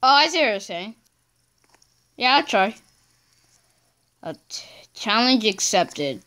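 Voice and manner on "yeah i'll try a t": ordinary talk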